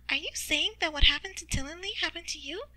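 high-pitched eager questioner; Skitter. Acted. Character speaks in narrated story.

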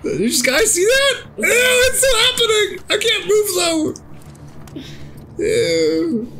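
high, crying shriek of disgust; disgusted squeal. Real, not acted. Gamer shrieking to friends at a glitching corpse, high disgusted wail. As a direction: squeal it out, grossed out and stuck.

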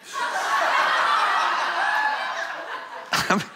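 a long, soaring burst of laughter; real laugh at an absurd question; very high laugh. Real, not acted. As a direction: let a huge high laugh escape.

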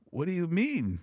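high, puzzled what do you mean; friend's bewildered question; confused question. Retold or imitated. Friend confused, asking back.

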